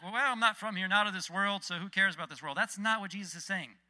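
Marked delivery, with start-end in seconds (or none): 0.0-2.6 louder, mock-breezy brush-off; pretend indifference. Preacher mimicking believers who pretend not to care, mock dismissive. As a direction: put on a careless shrug of a voice. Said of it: "we cannot just pretend like".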